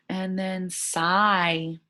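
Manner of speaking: "sigh" drawn out slow; slow teacher word read; long I. Shown as a demonstration. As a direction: stretch the I in "sigh". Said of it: "A sigh is a long I sound".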